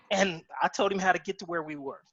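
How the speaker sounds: ordinary talk, nothing marked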